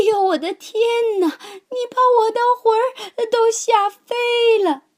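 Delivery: put-on high, loud shriek of a startled old woman; old woman's frightened cry. A narrator's reading. Old woman crying out at the lion's roar, high and loud. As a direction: scream as if your soul flew away. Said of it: "瘦小的老妇人喊起来".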